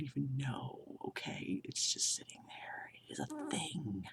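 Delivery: breathy whisper, half to herself; whispered aside. Real, not acted. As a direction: drop to a baffled whisper. Baffled aside about weird word.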